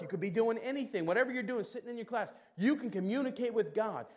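ordinary talk, nothing marked

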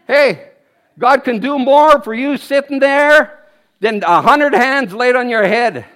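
ordinary talk

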